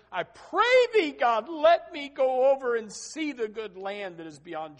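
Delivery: high, loud, slow desperate cry to God; Moses begging. Acted. Preacher acting Moses begging God to cross, a loud anguished cry. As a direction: cry out the plea high and stretched. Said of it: "Can you hear Moses?".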